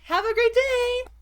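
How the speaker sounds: high, loud sing-out farewell; over-cheery goodbye. Retold or imitated. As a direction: send them off in a high customer-friendly chirp. Acted over-cheery goodbye to worker.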